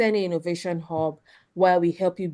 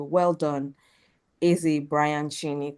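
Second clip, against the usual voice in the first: ordinary delivery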